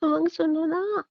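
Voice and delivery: high, slow, drawn-out call; coaxing call. Acted. Wife calls husband back, wheedling sing-out. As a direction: call out sweetly, stretching the last word. Calling husband from other room.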